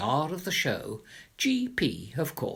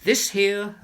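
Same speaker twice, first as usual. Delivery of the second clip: bossy order; 6 semitones higher, 6 dB louder than usual. Acted. Bossing the moving men.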